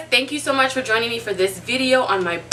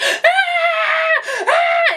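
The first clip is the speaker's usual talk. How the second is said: high, loud mock newborn cries; imitated baby scream; 4 dB louder than usual. Mother showing how her newborn screamed, high imitated cries. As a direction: wail like a baby just born. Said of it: "I hear the screaming".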